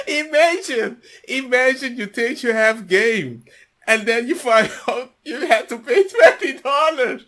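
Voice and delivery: loud, high laughing through words; can barely speak for laughing; helpless laughter. Real, not acted. Host laughing at the guest who paid twenty dollars, loud and breathless.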